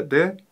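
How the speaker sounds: a light soft «д» at the end; soft final d. Shown as a demonstration. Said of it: "На конце мягкое".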